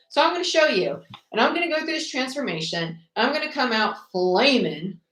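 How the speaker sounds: high, determined declaration; defiant vow. Acted. Tarot reader voices someone vowing transformation, defiant. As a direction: vow it like you'll prove them wrong. Voicing person vowing to prove themselves.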